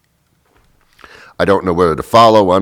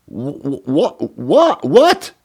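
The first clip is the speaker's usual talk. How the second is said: high, stammered what what; spluttering high-pitched confusion; sputtering disbelief; 6 semitones higher, much slower than usual. Retold or imitated. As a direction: sputter like you can't believe it.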